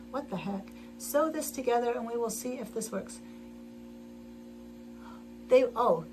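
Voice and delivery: usual speaking voice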